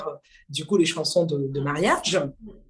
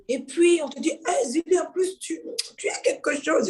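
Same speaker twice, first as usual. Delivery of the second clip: mock excited hype; 8 semitones higher than usual. Quoting people hyping her.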